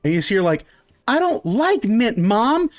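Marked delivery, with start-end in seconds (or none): 1.1-2.7 put-on much higher, slow whiny kid; a kid refusing mint ice cream; whiny child. Child complains loudly to mother.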